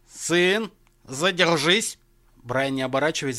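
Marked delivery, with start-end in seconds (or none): 0.1-2.0 raised, authoritative father's order; commanding. A narrator's reading. Father stops son at the doorway, commanding. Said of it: "властный голос отца остановил его".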